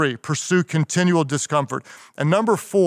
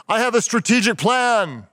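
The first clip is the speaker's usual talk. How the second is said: high-pitched parody of grand oratory; mock Martin Luther King; 8 semitones higher than usual. What he did not say, mock orator's proclamation. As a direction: proclaim dry words as a stirring speech. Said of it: "What did he not say?".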